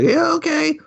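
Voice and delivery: very high, compliant chirp; mock little-girl voice. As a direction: squeak agreement like an obedient child. Voices the girl agreeing to Gabe.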